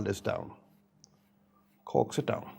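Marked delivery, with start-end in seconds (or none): 1.8-2.5 coaxing softly. Shown as a demonstration. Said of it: "Talk to it nice".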